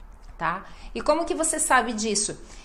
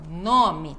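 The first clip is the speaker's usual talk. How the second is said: low "nome" with a long NO; stress on NO; 5 semitones lower than usual. Shown as a demonstration. Teacher showing penultimate stress, strong NO in "nome". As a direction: lengthen the first syllable of "nome". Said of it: "ênfase no nó".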